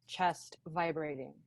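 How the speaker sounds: ordinary talk, nothing marked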